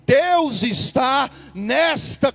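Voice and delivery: loud, drawn-out preaching cry; shouted preaching. Acted. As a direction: lift the church with it. (pregação gritada e arrastada)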